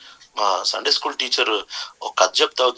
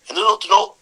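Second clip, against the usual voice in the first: higher, louder roaring question; lion's angry demand; 8 semitones higher, 4 dB louder, much faster than usual. Acted. Lion in a Sunday school story demands why the pig laughs, angry and loud. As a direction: bark it like an annoyed king of beasts.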